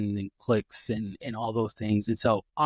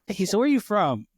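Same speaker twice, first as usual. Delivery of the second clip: mock cheery question; 8 semitones higher, 4 dB louder than usual. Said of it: "I'm gonna be like".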